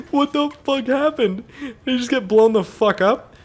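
high amazed disbelief; real amazed disbelief; astonished. Real, not acted. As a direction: ask it while still stunned. After explosion.

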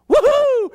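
high, loud whoop of delight; real joyful holler. Real, not acted. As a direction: let out a happy church whoop. Preacher whoops with joy.

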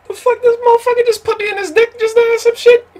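shocked squeal. Real, not acted. Shocked at opponent's move.

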